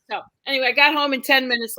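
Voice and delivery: ordinary talk, nothing marked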